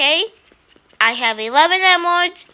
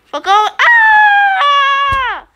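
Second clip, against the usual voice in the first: normal talking voice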